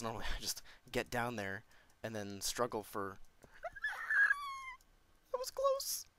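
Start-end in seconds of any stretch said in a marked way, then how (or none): 3.2-4.7 very high-pitched panicked squeal; weird noise. Real, not acted. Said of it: "sorry about that weird noise". 5.3-6.1 very high squeaked exclamation; real fright, voice shot up high; panicked relief. Real, not acted. Nearly fell into lava.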